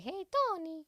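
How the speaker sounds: put-on high playful Italian accent calling out. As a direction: call his name like an Italian woman. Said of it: "I try to just do an Italian accent".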